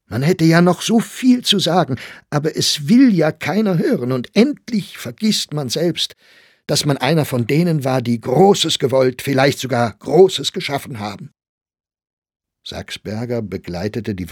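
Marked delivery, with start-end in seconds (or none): 0.1-11.3 a higher, louder, impassioned speech; impassioned outcry. Acted. Young man declaims to the old poet about forgotten artists, impassioned. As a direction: pour out the bitterness of the artist with fervour. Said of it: "rief er aus".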